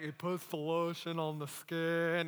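slow, lightly voiced movie creep; a hint of a creepy captor, drawn out; creepy kidnapper. Preacher imagining he will be kidnapped, creepy and slow.

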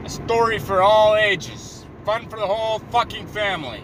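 trailer announcer. Pretend. Movie trailer parody.

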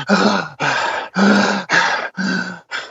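mock heavy breathing, getting heavier; imitated heavy breathing. Imitating her breathing to the listener, mock heavy gasps. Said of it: "she's, like, breathing".

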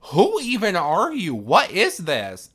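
loud, high mock indignation; acted-out incredulous challenge; outraged demand. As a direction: confront the intruder, voice way up and loud. Acted confrontation with an intruder.

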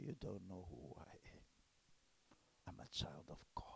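low hushed declaration; whispered reply. Pretend. Acted whispered reply to teacher.

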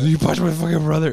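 high, loud imitated outburst; mock spazzing out. Brother confronting the puncher, mock freak-out. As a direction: yell it as a mock enraged accusation. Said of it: "he just spazzes".

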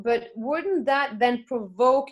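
usual speaking voice

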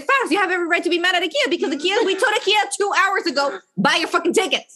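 angry at a friend for not buying tickets, heated quote; mad retelling. Retold or imitated. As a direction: scold her friend for waiting. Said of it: "And I was like".

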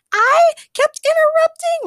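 very high, loud, slow indignant cry; indignant outburst. A narrator's reading. Morgana protesting to Ray, indignant. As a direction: protest it in outrage. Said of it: "Morgana started".

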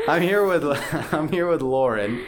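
laughing through the words; giggling while introducing. Real, not acted.

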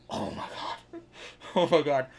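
imitated stunned whisper rising to a loud gasp; hammed-up breathless disbelief; mock awed gasp. Retold onlookers' awed gasp.

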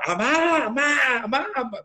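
put-on high desperate child's shout for mom; frantic mock cry of Amá; calling for mom. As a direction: yell for mom in a panic, voice shooting up. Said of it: "le empiezo a gritar a mi mamá".

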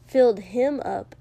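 usual speaking voice

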